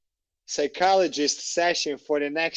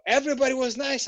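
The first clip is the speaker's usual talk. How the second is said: ordinary talk, nothing marked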